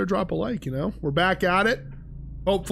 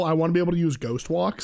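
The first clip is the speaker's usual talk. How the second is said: ordinary talk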